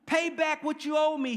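loud, high-pitched demand. A narrator's reading. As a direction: demand it forcefully, as a preacher voicing a creditor. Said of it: "he demanded".

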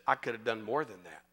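low, soft reply; understated, hushed correction; voicing God's answer. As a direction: drop down into a quiet divine voice. God's quiet answer, acted out.

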